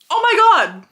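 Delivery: loud, high burst of disbelief; a real cry of disbelief that breaks off the recap; excited outburst. Real, not acted. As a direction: blurt it out, shocked and thrilled. Sudden outburst remembering plot twist.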